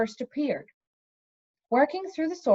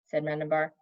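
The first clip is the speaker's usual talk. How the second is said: ordinary delivery, nothing marked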